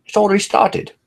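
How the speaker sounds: high-pitched mock audience voice; high put-on voice for a quoted exchange; imitating latecomers. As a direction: act out latecomers, pitched well up. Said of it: "people who come in late, they're just gonna be like".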